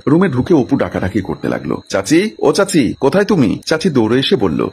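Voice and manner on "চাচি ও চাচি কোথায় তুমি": calling out. A narrator's reading. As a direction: call her from another room. Brother calls aunt through house.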